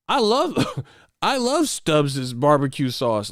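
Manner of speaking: high put-on voice breaking into laughter; mock poser voice. Poser announcing his favorite sauce, mocking voice. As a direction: gush slowly like a phony, cracking up.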